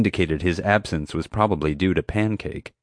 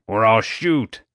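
threat; 2 semitones higher, slower than usual. Acted. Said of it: "he ordered".

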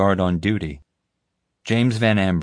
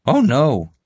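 high, eager, self-important voice; bumbling guard; 8 semitones higher, 5 dB louder than usual. Acted. Guard recounting the chase to the group, eagerly. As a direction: pitch it up and boast through the story.

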